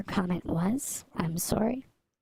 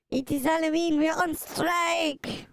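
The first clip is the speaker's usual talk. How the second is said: rally the strikers in a cartoon French accent; mock French protester; 9 semitones higher, 3 dB louder than usual. French rioter declaring a Halloween strike, mock French accent. Playing France revolting over Halloween.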